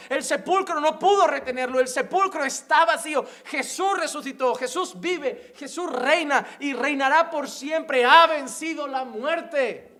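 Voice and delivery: high, loud preacher's cry; fiery preaching. Acted. Pastor to the church, fiery preaching on victory over death. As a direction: preach it with full conviction. Sermon at full force.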